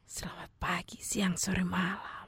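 very soft, low whisper; hushed, breath-only podcast opening; whispered greeting. Shown as a demonstration. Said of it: "seperti berbisik".

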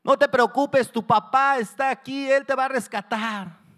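high, loud calls from a crowd; urgent shouted encouragement; onlookers shouting. Acted. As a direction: yell reassurance up to the trapped boy. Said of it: "y gritaban".

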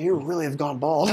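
ordinary talk

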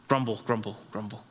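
sulky muttered grumbling; mock grumbling. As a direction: mutter it under the breath like a sulking churchgoer. Mocking grumble after complaint.

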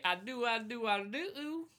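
high, climbing mock vows; mock emotional groom. Teasing a friend about his wedding vows, high mock-emotional voice.

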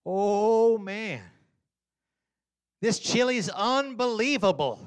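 loud, high gushing praise; overselling the chili. Retold or imitated. Dad selling chili to the boys, loud gushing. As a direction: hype the chili to sell it. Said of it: "I said".

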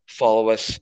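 ordinary talk, nothing marked

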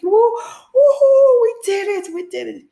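very high whoop, fading in volume; whoop, then trail down from the peak; screaming celebration. Retold or imitated. Everyone at the finish screaming out, jubilant. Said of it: "everyone was just screaming out".

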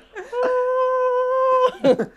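high squealing laugh, almost a cry. Real, not acted. Laughing at his stunned reaction, a high squeal.

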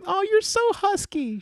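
put-on high, slow, cooing baby talk; squealing baby talk aunt. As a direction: coo it like a doting mother pinching cheeks. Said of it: "my mother used to pinch my cheeks and go".

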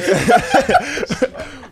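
a sudden, high-pitched cackle bursting out; high burst of laughter. Real, not acted. Laughing at friend's crude joke.

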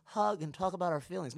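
high, sing-song mock cheer for a group hug; mock kumbaya voice. Mocking leaders who want group hugs, in a high sing-song. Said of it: "we can kumbaya all day".

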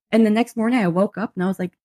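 ordinary delivery

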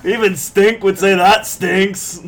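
high, loud, slow character voice; Fat Bastard. Acted. Reacting to his own smell, loud Fat Bastard impression. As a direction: belt out the punchline in the put-on voice. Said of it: "I'll do fat bastard".

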